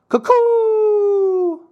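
very high, long hooted taunt; mocking cuckoo call. A narrator's reading. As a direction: jeer with a loud falsetto bird call. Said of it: "mocking them".

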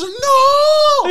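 long, wailing high shout; hammed-up howl of defeat; despairing scammer scream. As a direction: scream it like all is lost.

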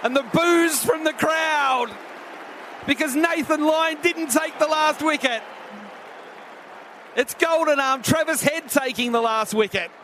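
excited call over crowd boos; cricket commentator. Acted. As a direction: rise for the catch. Live catch and all out call.

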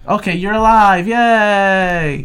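high, loud fake celebration; mock cheer. Friend mocks the revived character, hammed-up cheer. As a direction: cheer it like a cartoon win.

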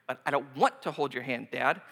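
put-on sulky kid. Son refusing to hold hand.

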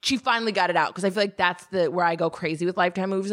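ordinary talk

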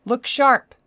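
high, loud urgent cry; warning shout. Acted. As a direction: yell a warning across the room. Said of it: "Park's warning cry rang out".

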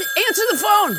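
high, insistent nagging order; urgent calling out. Acted. Co-host ordering the host to pick up, high and insistent. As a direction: pester him to pick up, pitched high. Ordering Adam to answer phone.

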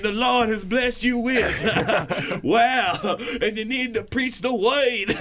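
high, drawn-out sermon cadence with gasping laugh-like breaths; fervent preacher. Acted. Pastor preaching scripture to his congregation, fervent. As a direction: stretch each phrase like a pulpit preacher.

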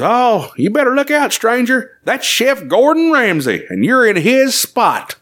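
high, drawn-out character voice; onlooker's warning. Acted. Character warns a stranger about Chef Gordon Ramsay, ominous high voice. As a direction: play a puppet bystander giving an ominous warning. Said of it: "and this guy goes".

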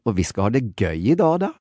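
Norwegian lilt, pitch rising; Norwegian sing-song. Shown as a demonstration. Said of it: "do the Norwegian again".

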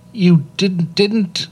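frightened stammer. Acted. Boy stammers to the angry cloud maker, scared and halting. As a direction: stumble over the words in fright. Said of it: "stammered Nye".

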